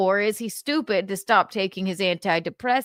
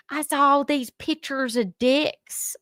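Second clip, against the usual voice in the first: high-pitched imitated Southern accent; 8 semitones higher than usual. Retelling a friend's website story in a high Southern accent. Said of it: "She has this charming Southern accent".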